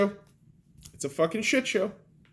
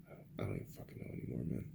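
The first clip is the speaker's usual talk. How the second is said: fast unvoiced whisper, much softer; 17 dB quieter, much faster than usual. Real, not acted. Muttering to himself about the country, fast whisper. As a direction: hiss it under your breath, worn out. Exasperated trailing-off whispered aside.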